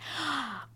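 sharp theatrical gasp before begging; child's gasp. Acted. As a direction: gasp like a kid about to plead. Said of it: "say please and beg and cry".

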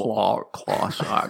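put-on slow staunch Hun accent, lip over teeth. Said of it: "you suck your bottom lip in over your teeth".

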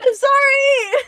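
very high, loud plaintive squeal; wailed apology. Real, not acted. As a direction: squeal your apology, drawn out. Apology to scolding co-host.

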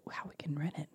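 unvoiced hushed question; whisper. Real, not acted. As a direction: drop to a whisper. Whispered aside.

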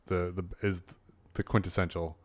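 ordinary talk

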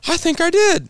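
high, loud winded squeak; mock breathless. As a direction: blurt it high, as if out of air. Retold breathless reply after laps.

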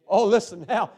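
ordinary delivery

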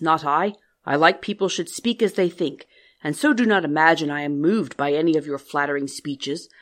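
loud, gruff monster; grotesque monster voice. Acted. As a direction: growl it out as the beast. The monster makes his demand.

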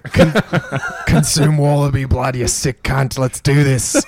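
put-on Aussie accent. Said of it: "You sound like fucking Rick".

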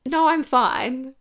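the unsaid, exasperated version of "No, I'm fine"; exasperated inner refusal. Retold or imitated. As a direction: drag out "No, I'm fine" with disbelief. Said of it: "obviously don't say that".

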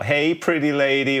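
loud, high-pitched catcall; catcalling biker. Acted. Biker to a woman alone in her car, loud catcall. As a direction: call out like a leering biker. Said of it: "one of the bikes sort of peels off".

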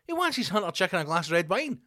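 high squeaky landlord voice shrugging it off; mock pub landlord. Landlord dismissing the old regular, in a high mock voice.

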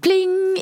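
high, drawn-out "pling"; mimicked bell ping held long; imitated chime. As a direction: ring out like a bell, stretched.

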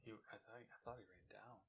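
hushed breathy whisper. Real, not acted. Hunting the spider, whispering to the host. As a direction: keep it to a whisper.

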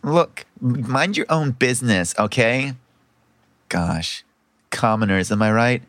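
haughty, low-voiced dismissal of a commoner; snooty noble, pitched well down; Nat. Sister telling an eavesdropper off, haughty and dismissive.

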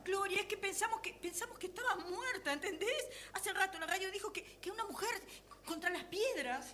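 soft, high, trembling voice; shaken. Acted. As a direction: still trembling from the scare. Shaken after thinking her dead. (voz temblorosa y asustada)

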